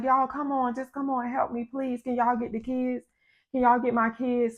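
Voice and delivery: high, softened begging voice; quiet high-pitched plea; imitated pleading. Neighbor begging for help, retold.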